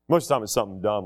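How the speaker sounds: ordinary delivery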